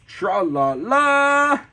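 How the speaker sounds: slow, loud singsong hero cry; Captain Underpants. Acted. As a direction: belt it out, climbing to a long last note. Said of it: "Here's Captain Underpants".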